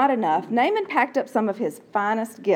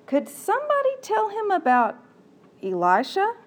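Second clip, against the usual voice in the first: hopeful servant; 10 semitones higher than usual. Acted. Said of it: "here's what I think it might sound like".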